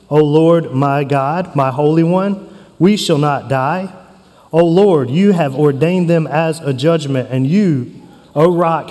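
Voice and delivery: solemn scripture reading. Acted. Preacher reading the prophet's prayer to his church, solemn. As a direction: read it as a reverent prayer.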